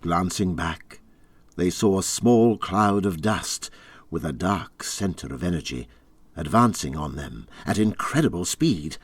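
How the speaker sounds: plain reading voice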